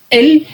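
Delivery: Él alto y aislado, luego corte; pausa tras Él. Shown as a demonstration. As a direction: marca Él con pausa marcada. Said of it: "hay una barrera de sonido". (pronuncia Él separado por una pausa)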